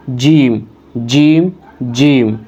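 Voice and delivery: "جیم" with the tongue pressed to the palate; letter demo. Shown as a demonstration. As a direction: model "جیم" firmly for the student. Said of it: "جیم جیسے آپ سن پا رہے ہوں گے".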